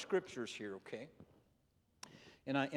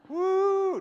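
a high, loud whoop of amusement; whooping exclamation; 11 dB louder than usual. Real, not acted. Preacher whooping at the neighbor running off. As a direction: let out a big woo.

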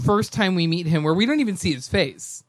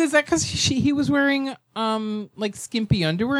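higher, confused asking; high puzzled question; 7 semitones higher than usual. Retold or imitated. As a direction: ask it baffled. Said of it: "And I go".

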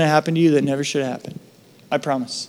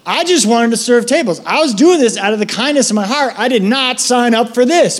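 higher, exasperated I'm-done voice; mock complaint, raised pitch; fed-up quitting; 6 semitones higher than usual. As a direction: throw up your hands and quit. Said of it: "I'd be like".

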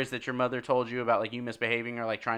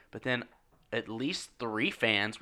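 ordinary talk

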